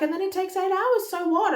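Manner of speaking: high, loud, carefree "so what"; breezy midwife. Retold or imitated. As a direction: brush the worry off lightly. Midwife dismissing her worry.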